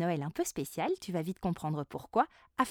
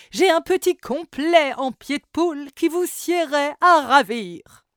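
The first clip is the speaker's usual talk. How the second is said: flamboyant salesman; 10 dB louder than usual. Acted. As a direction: gush over the costume. The seller pitches his coats.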